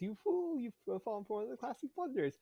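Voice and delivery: a lightly voiced Vizzini, fast; mild Vizzini impression; Princess Bride quote. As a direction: rattle off the gloating speech. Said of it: "how the guy says".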